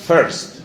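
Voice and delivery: "First" with an e-like vowel, "ferst"; corrected pronunciation. Shown as a demonstration. Teacher repeating the model for a learner, corrected pronunciation. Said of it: "se dice first".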